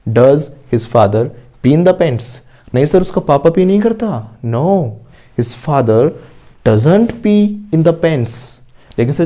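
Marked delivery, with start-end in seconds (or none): 0.1-2.4 very slow, word by word, for students to repeat; slow model sentence. Shown as a demonstration. Teacher modelling an answer for the class, very slowly. 4.4-8.3 very slow, word by word, for students to repeat; slow model sentence. Shown as a demonstration. Teacher modelling an answer for the class, very slowly.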